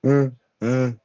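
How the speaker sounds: humming with a mouth full. Retold or imitated. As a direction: mm through closed lips. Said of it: "And I was like".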